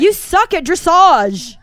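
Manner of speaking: loud, high, slow jeering yell; mock yelling parent. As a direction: bellow it like a nasty horse mom. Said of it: "parents yelling at their kids".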